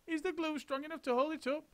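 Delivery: high fretful question; nervous helpers; high worried chorus; worried monkeys. A narrator's reading. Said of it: "they cried".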